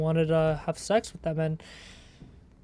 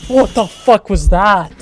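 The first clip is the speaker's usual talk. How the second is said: loud, shocked what the fuck; startled outburst; 2 semitones higher, 10 dB louder than usual. Real, not acted. Startled by something in the game, shouting in shock. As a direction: blurt it out, caught off guard.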